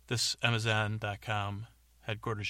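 normal talking voice

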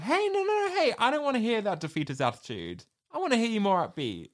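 high, fast mock cheerleading; upbeat pep talk. Quoting Michael cheering up a gloomy Cameron, high and fast. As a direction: cheer your friend up fast and bright.

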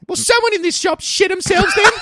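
put-on high, loud cheeky child; little girl voice. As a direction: squeal it like a cheeky kid. Girl's retort, high voice.

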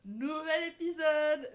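bursting with high, loud excitement; overexcited. Real, not acted. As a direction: greet them like you've had too much caffeine. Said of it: "je suis surexcitée".

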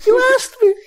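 whiny defensive note taker; high Amethyst voice. Acted. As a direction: Amethyst whining at the inventor, high-pitched.

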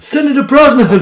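high, loud mock announcer call. Cohost introducing the guest, hammed-up announcer call. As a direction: boom out the senator's name like a host. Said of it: "A couple of great things about that impression".

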